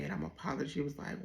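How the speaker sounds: ordinary talk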